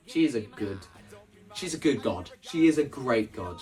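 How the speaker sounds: ordinary talk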